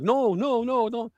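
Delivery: high, mild pleading no; mock whimpering kids. Voicing the crying kindergartners after the yell. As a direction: play the frightened kids lightly. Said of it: "you're like".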